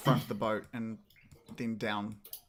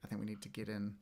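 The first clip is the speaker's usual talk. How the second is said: softer, hushed words meant only for one companion; hushed aside; 6 dB quieter than usual. Acted. Finn leans to Alst and urges checking below deck, hushed. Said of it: "leans over to Alst and whispers".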